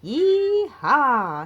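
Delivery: loud high yee-haw; cowgirl whoop. Acted. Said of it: "says Captain Feathersword".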